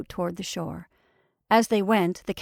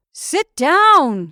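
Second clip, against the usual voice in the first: high, loud command; insist, calling it out; insistent order; 7 semitones higher, 9 dB louder than usual. A narrator's reading. Repeated order in rocking boat.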